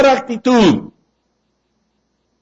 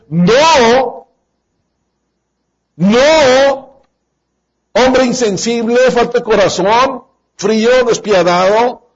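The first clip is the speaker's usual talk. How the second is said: higher, drawn-out indignant no; indignant reply; 4 semitones higher, slower than usual. Retold or imitated. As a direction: scold the heartless husband. Respuesta de Ana al marido. (respuesta indignada al marido insensible)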